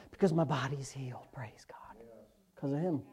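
hushed, breathy words of thanks; whispering praise God; whispered thanks. Real, not acted. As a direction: drop to a whisper of gratitude. Whispered testimony of healing.

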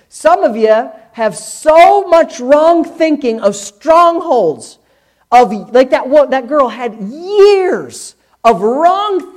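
loud, high, drawn-out preaching; full-force preaching. Real, not acted. Preacher driving home to congregation that change takes time, loud and emphatic. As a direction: preach it hard, stretching key words.

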